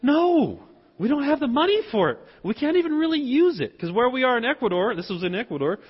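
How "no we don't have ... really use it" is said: high, emphatic imitation of wife; mock wifely refusal, pitched right up; quick, insistent retold objections; objecting wife. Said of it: "and she said".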